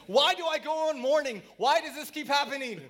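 high, loud, dramatic lament; exasperated outcry. Acted. Psalmist complaining to God about mourning, loud and exasperated. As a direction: cry out in protest.

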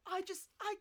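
gushing landlord. Retold or imitated.

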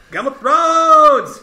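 loud, drawn-out, high goofball holler; goofy dumb voice. Shown as a demonstration. Host testing the TV voice remote, goofy dumb voice. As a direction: goofiest voice you can, belted and stretched. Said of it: "put on the goofiest accent or dumbest voice".

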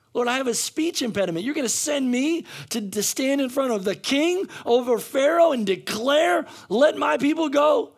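acted, overwhelmed objection to an order; incredulous protest. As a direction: protest in disbelief at being sent. Acts Moses protesting God's call.

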